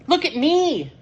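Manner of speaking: high, bright little cat voice; a small cat showing off; Kitty's voice. A narrator's reading. To her friends, Kitty shows off in a high voice. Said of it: "says Kitty".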